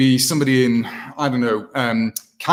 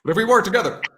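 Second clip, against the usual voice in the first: higher, halting presidential oratory with long pauses; former president; 6 semitones higher than usual. Acted. Impressionist addressing Americans over there, former-president voice. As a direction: speak in measured bursts like a former president. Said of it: "a former president who kinda talks like this".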